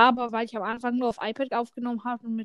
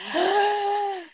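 mock gasp; 7 semitones higher than usual. Gasp at guess.

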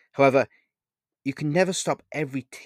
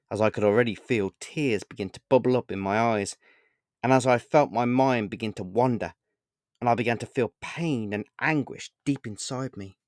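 usual speaking voice

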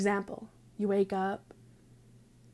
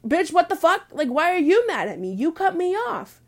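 loud, high-pitched outraged comeback; retold road-rage protest; indignant retort; 10 semitones higher, 8 dB louder than usual. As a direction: fire back angrily, voice raised high.

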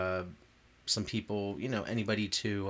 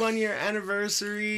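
loud, high celebratory announcement; hype announcer call; 11 semitones higher, 5 dB louder than usual. Acted. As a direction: hype up the anniversary. Hype show intro.

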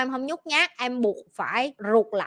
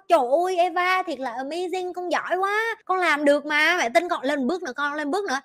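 higher, gushing praise; cheering mother; 5 semitones higher than usual. Retold or imitated. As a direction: hype the child up the slide. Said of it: "Tao phải ở dưới tao động lực nó vậy".

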